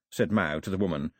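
ordinary delivery

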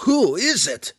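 high, loud shouted question; shouting. A narrator's reading. Belwar demanding an answer from Drizzt, shouting. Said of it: "Belwar shouted back at him".

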